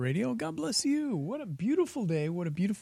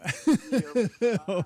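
high, loud burst of laughter; helpless giggling burst; laughing; 6 semitones higher, 4 dB louder than usual. Real, not acted. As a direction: laugh at your own fumbled attempt. Laughing at own attempt at name.

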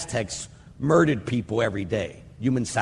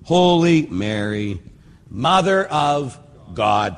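slow, deliberate Holy Mary; unhurried devotional recitation; slow prayer recitation; 3 dB louder, slower than usual. Shown as a demonstration. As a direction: draw out each word of the prayer. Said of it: "we're saying that Mary's holy".

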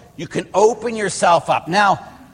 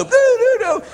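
high sung doorbell chime; a playful high doorbell imitation; imitated doorbell; 4 dB louder than usual. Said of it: "the doorbell's ringing".

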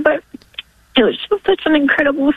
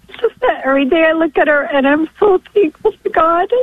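crying with gratitude, high voice; high, tearful and overwhelmed; emotional; 5 semitones higher than usual. Real, not acted. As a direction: speak through real tears, pausing often. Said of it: "wasn't expecting to get so emotional".

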